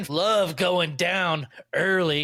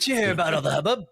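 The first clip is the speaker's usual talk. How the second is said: mock Australian; faster than usual. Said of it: "Australian friend".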